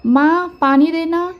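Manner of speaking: high, loud call to mother across the house; calling out loudly. A narrator's reading. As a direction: shout for mom from the next room. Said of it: "ज़ोर-ज़ोर से चिल्लाने लगे". (ज़ोर से पुकारते हुए)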